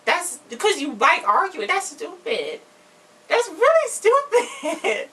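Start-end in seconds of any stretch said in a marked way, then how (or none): 3.3-5.1 laughing scoff. Real, not acted. Mocking friend, laughing.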